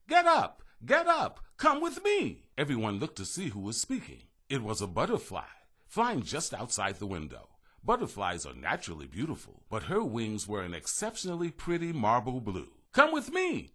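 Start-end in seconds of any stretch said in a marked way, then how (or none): 0.1-2.4 very high, loud little butterfly voice; butterfly calling. A narrator's reading. Butterfly urging the gingerbread men, high loud call. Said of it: "a voice came from nowhere". 12.9-13.7 high, loud butterfly plea; urging. A narrator's reading. Butterfly urging from the window, high insistent call. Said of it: "she urged again".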